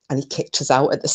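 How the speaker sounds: plain reading voice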